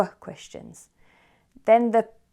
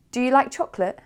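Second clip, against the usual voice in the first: "chocolate" pitched up to close; rising question tune; 6 semitones higher, 5 dB louder, faster than usual. Shown as a demonstration. Teacher models a yes-no question, rising on "chocolate". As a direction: send the voice up on "chocolate". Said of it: "our voice goes up at the end".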